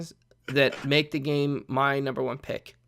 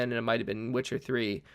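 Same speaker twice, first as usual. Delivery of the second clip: ordinary delivery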